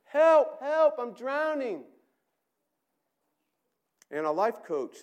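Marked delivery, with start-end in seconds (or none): 0.0-1.9 frantic high scream for help; desperate drowning cry; shout. Acted. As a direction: yell as if going under. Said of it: "shouts instructions".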